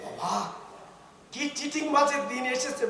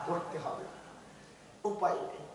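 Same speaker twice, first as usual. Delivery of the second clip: much softer, almost whispered; dropped hush; 4 semitones lower, 13 dB quieter than usual. Real, not acted. Preacher ending his warning in a hush. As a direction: let it fall to a hush. Drop after the shout.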